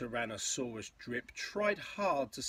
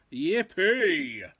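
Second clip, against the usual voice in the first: louder, drawn-out cheer; gleeful cry; 5 semitones higher, 11 dB louder than usual. A narrator's reading. Tyrannosaurs spot a way across, gleeful cry. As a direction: cheer it out in storybook glee. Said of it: "the teas cried out".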